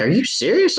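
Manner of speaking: can't believe what the wildlife officer said; incredulous. Retold or imitated. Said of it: "I was like".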